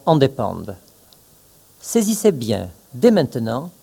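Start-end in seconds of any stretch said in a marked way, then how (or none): none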